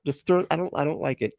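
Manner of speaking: ordinary delivery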